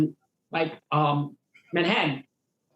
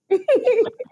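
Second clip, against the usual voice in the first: a loud burst of real laughter; big laugh; 5 dB louder than usual. Real, not acted. Comedian telling his first gig story, bursting into loud laughter. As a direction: laugh out hard, high and loud.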